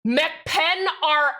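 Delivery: ordinary talk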